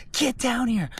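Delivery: high, strained imitated scream; retold screaming. Hiker imitating the man who keeps screaming, high strained yell. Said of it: "this guy keeps screaming".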